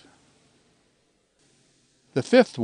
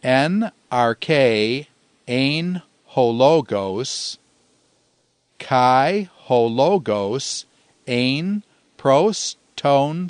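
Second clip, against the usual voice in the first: slow, measured Greek with pauses between phrases; say-along recitation; much slower than usual. Shown as a demonstration. Teacher leading all three memory lines for repetition, slowly recited. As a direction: recite word by word so learners can follow. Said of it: "repeat with me all three lines".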